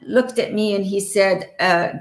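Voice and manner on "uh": ordinary delivery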